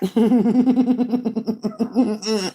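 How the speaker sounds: long rapid string of chuckles; taunting chuckle. Real, not acted. Telling Wombat to stop looking, long taunting chuckle. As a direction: keep chuckling at your own jab.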